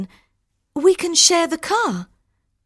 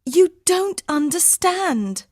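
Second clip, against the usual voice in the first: high, slow, insistent plea; desperate pleading; 4 dB louder, slower than usual. Acted. Harminder pleads at the ticket office, desperate. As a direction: make the clerk understand.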